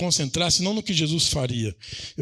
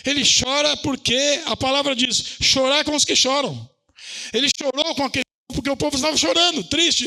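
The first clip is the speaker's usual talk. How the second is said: fiery preaching; 7 semitones higher than usual. Acted. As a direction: stir the church with Jesus' tears. Preaching at full force. (pregação inflamada, aguda e forte)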